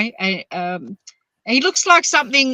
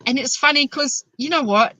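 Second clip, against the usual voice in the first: usual speaking voice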